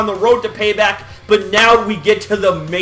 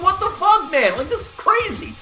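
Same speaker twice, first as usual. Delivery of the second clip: amazed disbelief; 9 semitones higher than usual. Retold or imitated. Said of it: "I'm just like".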